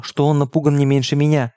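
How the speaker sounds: plain reading voice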